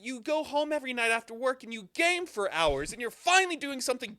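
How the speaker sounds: high-pitched, worked-up scolding; animated re-enactment of telling off a gym buddy; very upset. Retold or imitated. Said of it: "I got very upset".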